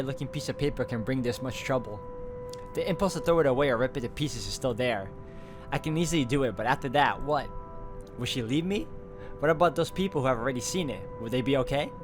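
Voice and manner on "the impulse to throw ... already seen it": ordinary delivery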